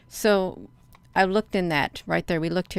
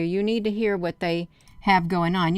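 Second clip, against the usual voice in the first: usual speaking voice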